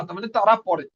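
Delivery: ordinary delivery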